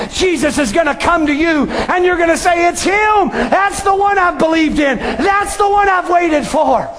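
shouting testimony from the pulpit; fervent preaching. Acted. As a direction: preach it out with full fervor. Preacher voicing the believer.

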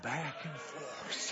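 low, soft, very slow phrase; slow swaying. Real, not acted. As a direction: stretch it out like a gentle sway. Slowly pictures swaying trees.

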